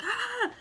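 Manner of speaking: sharp high yelp of pain; pained cry. Retold or imitated. Mother in labor yelps at the sudden tug. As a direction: cry out as the cord is tugged.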